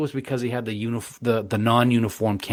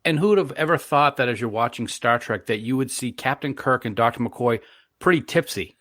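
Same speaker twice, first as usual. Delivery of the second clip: usual speaking voice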